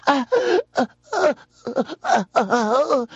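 high, hammed-up frightened whimpering; mock panicked whimpers. Imitating dad's friend shown a revolver, mock panicked whimpers. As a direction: whimper in short, high mock-terrified bursts. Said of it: "And the guy's going".

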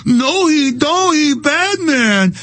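put-on high, slow, babyish sing-song; high childish mock TV voice, slow and whiny; mock kindergarten voice. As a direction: talk down like to a toddler. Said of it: "like I'm in kindergarten again".

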